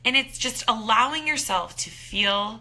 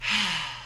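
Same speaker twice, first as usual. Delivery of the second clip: slow audible outbreath to show a deep breath; deep release sigh; 3 semitones lower than usual. Shown as a demonstration. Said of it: "take a couple deep breaths".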